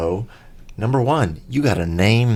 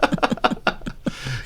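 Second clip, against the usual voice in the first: long, breathy laughing fit; real laugh; 3 semitones higher, 5 dB quieter than usual. Real, not acted. Host laughing after joking about fifty messages, real laugh. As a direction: laugh it out until the breath runs thin.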